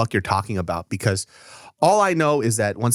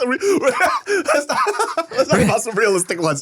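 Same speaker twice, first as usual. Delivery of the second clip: laughing; 12 semitones higher, much slower than usual. Real, not acted. Laughing outburst.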